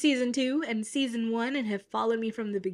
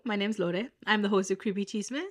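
usual speaking voice

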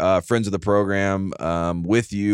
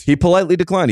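echoed higher and louder; 10 semitones higher, 4 dB louder, faster than usual. Real, not acted. Host echoing his co-host about the declined invite, higher and louder. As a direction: repeat it higher and louder, amused.

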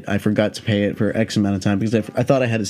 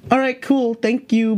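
squeaky high, eager "all right, cool"; relieved thanks; 14 semitones higher, slower than usual. Retold or imitated. High driver thanking the cop who just called it a felony, mock-relieved squeak. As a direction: chirp it out in nervous relief.

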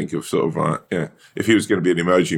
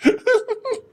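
high laugh. Real, not acted. Laugh after the impression.

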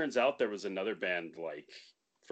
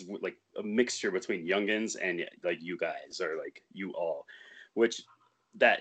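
usual speaking voice

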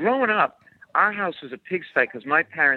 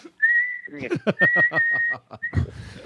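high squeal. Retold or imitated. Mimics whistling hearing aid.

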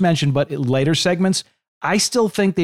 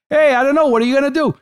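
much higher, louder regular-guy interjection; throw-in guy; 11 semitones higher, 4 dB louder, faster than usual. Acted. Co-commentator interjecting on a wrestling panel, high-pitched throw-in guy voice. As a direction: throw it in like a real person on the panel. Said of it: "you need a guy to go".

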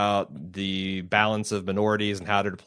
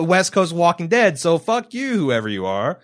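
higher and louder mock tirade; hammed-up outrage at an unseen producer; a playful fake rant, not real anger; 7 semitones higher, 6 dB louder than usual.

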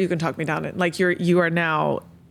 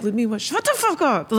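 higher-pitched playful outburst; mock angry outburst; 6 semitones higher than usual. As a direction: snap in mock exasperation, half laughing. Retold outburst at her coach.

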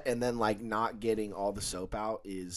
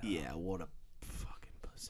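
whisper; 5 semitones lower, 13 dB quieter than usual. Acted. Mocking the old man.

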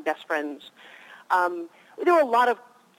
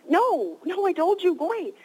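higher, mock-exasperated no, no; exasperated mom; 8 semitones higher than usual. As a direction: shoo the kid off in mock annoyance, pitched up. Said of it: "And I said".

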